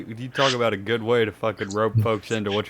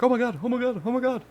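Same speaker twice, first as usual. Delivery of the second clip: panicked exclaiming; 10 semitones higher than usual. Pretend. Panicked onlooker at fireworks.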